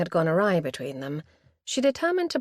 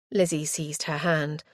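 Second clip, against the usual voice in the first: usual speaking voice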